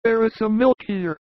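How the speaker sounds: high, knowing advice; fatherly shepherd. A narrator's reading. Narrator voices a shepherd.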